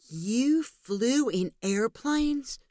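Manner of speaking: put-on high, slow, wide-eyed child voice; little boy in awe; amazed child. A narrator's reading. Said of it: "Jacob asked in amazement".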